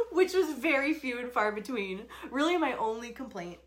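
laughing through the words. Real, not acted. Teasing about her husband Johnny, laughing.

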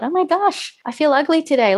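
high, dramatic dismay over her skin; mock dismay. Retelling her thoughts to the host, in mock dismay. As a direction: play up the panic about looking ugly. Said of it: "I just thought".

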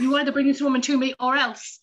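half-joking ultimatum shouted at the universe; mock threat. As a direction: demand it like a playful threat. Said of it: "threatening the universe".

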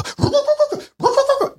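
sputtering lawnmower noise, high; mouth-made engine sputter; imitated engine. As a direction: mimic a mower trying to start. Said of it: "that's my version of the lawnmower starting up".

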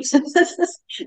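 high bright laugh. Real, not acted. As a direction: burst of laughter. Laugh after retelling.

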